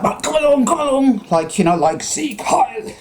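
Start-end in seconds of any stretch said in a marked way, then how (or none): 0.0-1.2 much higher, brisk bossy call; mocking a bossy woman calling her dog; bossy dog walker. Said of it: "she was like". 2.0-2.9 mock salute. Comparing her to a Nazi.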